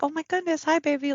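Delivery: put-on high, gushing hello to a child; delighted greeting. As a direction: coo over a beloved little kid. Greeting little sister with hug.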